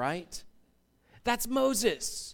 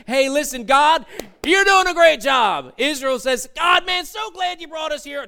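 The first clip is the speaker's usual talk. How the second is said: higher and louder hammed-up praise; mock cheering praise; 6 semitones higher, 7 dB louder than usual. Moses and Israel flattering God, mock loud and high. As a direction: gush at God like an eager fan. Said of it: "look at him and say".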